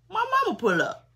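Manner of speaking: high, loud disbelief at mama pulling up; can't believe mama just pulled up; shocked. Retold or imitated.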